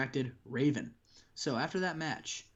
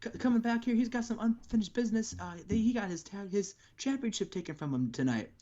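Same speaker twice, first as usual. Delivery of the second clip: imitating commentator; 9 semitones higher than usual. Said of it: "And they go".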